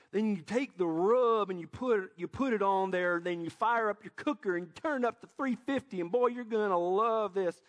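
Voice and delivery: imitating a Tennessee barbecue YouTuber; Tennessee accent. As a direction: lay on a smooth Tennessee twang like a barbecue host. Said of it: "he has a smooth Tennessee accent".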